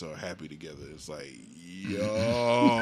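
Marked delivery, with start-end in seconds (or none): 1.6-2.8 stunned reaction. Retold or imitated. Acts stunned reaction to post.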